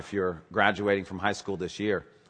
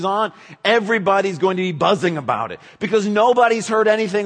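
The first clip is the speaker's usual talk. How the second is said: loud, high, emphatic sermon delivery; full-force preaching; 11 semitones higher, 11 dB louder than usual. Acted. Pastor preaching to the congregation, full force. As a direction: preach it out to the whole room.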